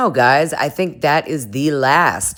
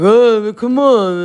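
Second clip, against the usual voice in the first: put-on a much higher, louder, whining 'come on' from a drunk guy; drunk whine; 8 semitones higher, 6 dB louder than usual. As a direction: plead like a tipsy boyfriend who won't let it go. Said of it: "And he's drunk and whatever".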